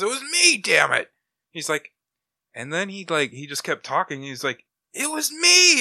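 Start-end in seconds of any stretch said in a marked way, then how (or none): 0.0-1.1 high, loud retold confession shout; heated outburst. Comic villain admitting he was the defeated warrior, exasperated shout. 4.9-5.8 very high, loud drawn-out yell; exasperated shout. Retold or imitated. Villain insisting to the hero it was him, exasperated shout. Retold villain repeats his confession.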